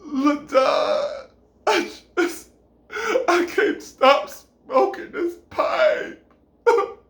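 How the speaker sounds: high, hammed-up crying through words; mock sobbing confession. Woman confesses addiction to him, theatrically sobbing. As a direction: sob it out in slow, broken bursts.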